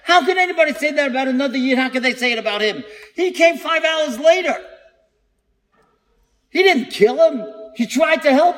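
high-pitched, indignant pleading, retold; hurt protest. Storyteller voicing the rescuer's hurt at being blamed, hurt protest. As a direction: voice the wounded disbelief of someone wrongly blamed. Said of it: "He was so hurt".

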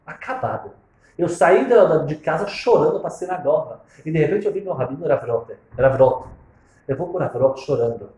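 normal talking voice

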